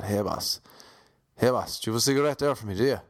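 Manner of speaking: fast, voiced Irish Traveller accent; Irish Traveller accent, quick and full-voiced; pikey accent. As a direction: rattle it off like a cheeky Traveller kid. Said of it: "Yeah, it was kinda like that".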